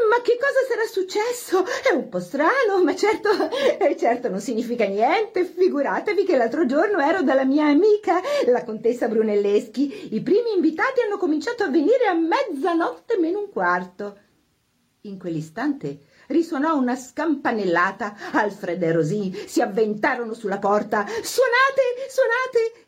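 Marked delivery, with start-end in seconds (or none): 0.1-14.2 high, agitated hostess voice; anxious hostess. A narrator's reading. Hostess wonders why no guests have come, agitated. 21.0-22.8 high, urgent cry; shouted order. A narrator's reading. Rosì to the musicians as the doorbell rings, shouting. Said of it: "gridò Rosì ai musicisti".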